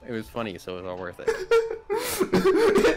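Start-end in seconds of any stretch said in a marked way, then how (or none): 1.2-3.0 much higher, slow, laughing words; squeaky laughing. Real, not acted. Laughs at own mistake.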